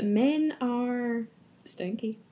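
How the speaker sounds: slow, whiny pout. Pretend. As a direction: drag it out like a sulky kid. Mock disgust at men.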